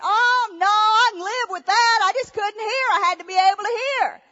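loud, high-pitched imitation of a deaf woman; deaf woman hollering. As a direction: holler it back, hammed-up and insistent. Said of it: "I think she was deaf".

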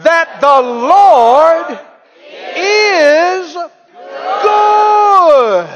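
slow start, rising to a high loud shout on good; building shout. Acted. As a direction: drag out the Lord, then blast good. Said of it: "One more time".

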